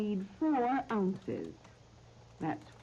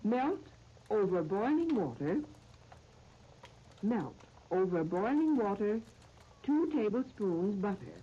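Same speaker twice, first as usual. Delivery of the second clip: slow, measured phrases with long pauses; recipe dictation; slower than usual. Real, not acted. Radio host reading out a recipe to listeners, slow dictation. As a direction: dictate it piece by piece, leaving time to write.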